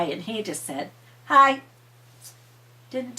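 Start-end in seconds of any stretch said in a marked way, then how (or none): none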